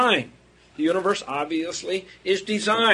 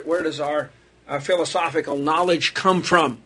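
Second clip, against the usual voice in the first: ordinary talk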